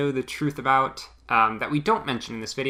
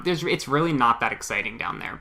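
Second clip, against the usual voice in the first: normal talking voice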